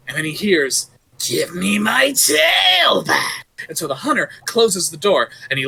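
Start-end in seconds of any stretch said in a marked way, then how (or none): 1.2-3.5 spooky monster shout. Acted. Creature calling again.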